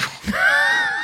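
shrieking burst of laughter; loud shrieking laugh. Real, not acted. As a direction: scream with laughter. Surprise reveal, loud laugh.

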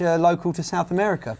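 ordinary delivery

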